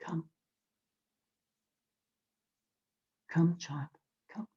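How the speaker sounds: whispered gentle call; a hushed inner voice calling the child; soft voice. A narrator's reading. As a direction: beckon softly, almost a whisper. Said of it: "you hear a soft voice".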